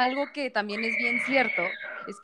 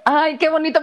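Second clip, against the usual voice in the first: high, louder gush over a gift; delighted gush; 5 semitones higher, 8 dB louder than usual. Real, not acted. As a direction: coo loudly at the sweatshirt. Admiring gift on camera.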